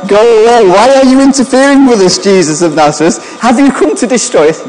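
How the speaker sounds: high, loud panicked demon voice; freaking-out evil spirit. Acted. Evil spirit confronting Jesus of Nazareth, freaking out. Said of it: "This evil spirit in this man is freaking out".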